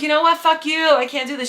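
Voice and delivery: put-on loud fed-up retort to the lying boyfriend; angry outburst. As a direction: shout it at him, fed up. Linda finally dumps the liar.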